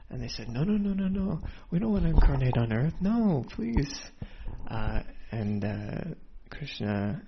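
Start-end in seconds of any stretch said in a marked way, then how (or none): 0.5-4.0 higher, louder frantic begging; desperate pleading. Retold or imitated. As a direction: plead with Krishna not to be sent. Entities begging Krishna not to incarnate.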